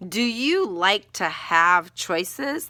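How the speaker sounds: drawn-out "Do you like to have choices?" rising at the end; slow, rising question. Shown as a demonstration. Teacher trying a better opening question for students, slowly. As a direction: ask it slowly, hook the reader. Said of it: "No, I'm gonna say".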